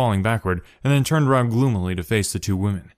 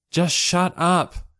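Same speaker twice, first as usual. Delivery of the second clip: much higher, slow firm order; commanding; 9 semitones higher, much slower than usual. A narrator's reading. Sho steps between squabbling siblings, commanding. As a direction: lay down the law. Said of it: "she commanded".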